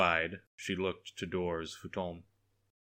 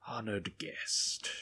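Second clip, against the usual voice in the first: slow, soft, sugary with hidden venom; sweet tones hiding venom; sweet but venomous; 4 semitones higher, 4 dB quieter, much slower than usual. A narrator's reading. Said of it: "He spoke in sweet tones, but an undercurrent of venom".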